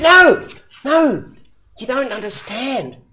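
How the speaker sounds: high, loud wailing protest; a heartbroken man cutting him off; distraught bloke. Acted. Joke teller voicing the crying drinker, mock wail. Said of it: "He said".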